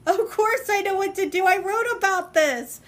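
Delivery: the lightbulb moment, lifted; real excited realization; sudden realization. Real, not acted. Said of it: "it hit me".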